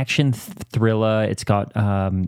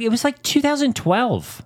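incredulous; 13 semitones higher than usual. Retold or imitated. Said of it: "I'm like".

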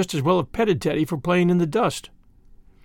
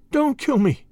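high, pleading little voice; almost weeping; 8 semitones higher than usual. A narrator's reading. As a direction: plead as if near tears, understated. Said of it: "almost weeping".

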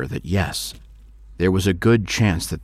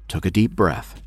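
ordinary delivery

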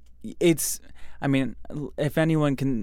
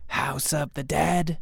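slow eerie drawn-out voice; slow spooky ghost voice; 4 dB quieter, slower than usual. Acted. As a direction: moan it like a ghost.

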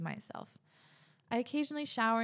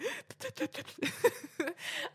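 high sputtering gasps, acted out; comic panicked puffing for air; imitated struggling breath. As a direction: mimic gasping with a blocked nostril, then laugh. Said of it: "breathe out of, I'm like".

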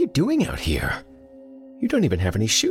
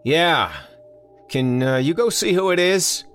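higher, louder call across the house; yelling back; 12 semitones higher, 4 dB louder than usual. Acted. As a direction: shout back to the kitchen. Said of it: "he yelled back".

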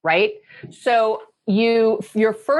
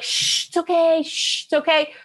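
hushing; 7 semitones higher than usual. Retold or imitated. Parent hushing a crying child.

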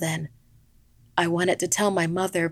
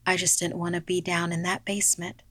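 ordinary delivery, nothing marked

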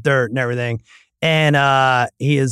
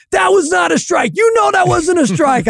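put-on much higher kid's voice, loud and indignant; whiny kid protest; 4 dB louder than usual. Son protesting the called strike to his dad, high and whiny. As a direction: squeal it like an outraged ten-year-old.

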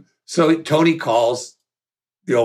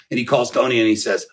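ordinary talk, nothing marked

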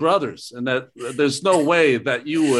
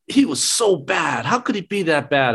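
fed-up fan; 5 semitones higher than usual. Retold or imitated. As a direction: whine it like an angry fan on the subway. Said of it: "They'll go".

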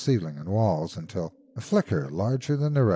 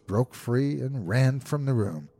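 ordinary delivery, nothing marked

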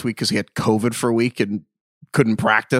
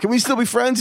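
Ricky Bobby; 8 semitones higher than usual. Retold or imitated. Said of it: "and he's like".

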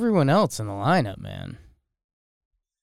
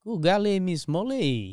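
a higher, hammed-up French accent; mock French accent; 7 semitones higher than usual. Shown as a demonstration. Asked to try the phrase in a French accent. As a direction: toss it off in a fake French accent. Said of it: "fun in a French accent".

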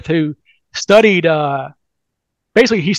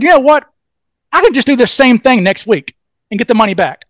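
Mac's sudden idea, higher and louder; excited Mac; 5 semitones higher, 4 dB louder than usual. Retold or imitated. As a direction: burst out with the bright idea. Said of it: "this is the way Mac talks".

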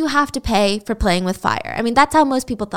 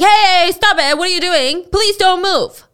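higher, louder order to stop; police shout; 8 semitones higher, 5 dB louder than usual. Acted. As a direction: yell to break up the fight.

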